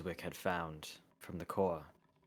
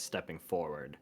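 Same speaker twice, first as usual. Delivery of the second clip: ordinary delivery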